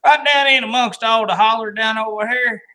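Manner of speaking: hammed-up Southern accent. Joking host hams up a Southern accent for viewers. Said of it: "We doing accents?".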